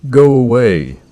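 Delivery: loud panicked shout. A narrator's reading. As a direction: yell it at the door. Scared narrator yells at door.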